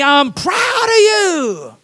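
high, slow, ringing praise; loud praise. Shown as a demonstration. Parent praising a child, loud and thrilled. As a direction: cheer your kid on at full voice. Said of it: "when you're praising your kids, be loud and exciting".

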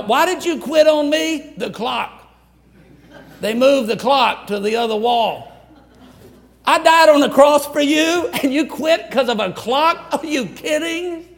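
like God can't believe the excuse; incredulous God. Retold or imitated. God confronting quitter over clock.

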